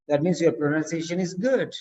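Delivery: normal talking voice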